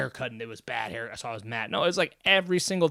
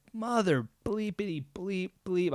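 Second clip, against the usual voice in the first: mock self-scolding; 6 semitones higher, slower than usual. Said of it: "And I said".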